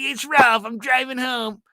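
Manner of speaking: high, shouted gushing voice; excited Ralph. Retold or imitated. Coworker hearing about Disneyland trip, high excited gushing. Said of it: "He's like".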